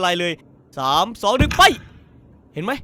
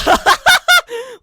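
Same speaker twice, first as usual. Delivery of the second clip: high cackling laugh; loud laugh; 9 dB louder than usual. Real, not acted. Laughing at two turtles meeting. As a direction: crack up loudly.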